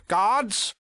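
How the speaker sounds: high, loud summoning shout; sudden commanding yell; calling for guards. Acted. Villain calls for guards.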